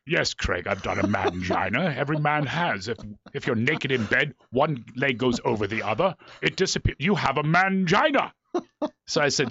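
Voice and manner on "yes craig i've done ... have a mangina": Patrick Stewart. Retold or imitated. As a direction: answer with mock Shakespearean dignity. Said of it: "And he goes".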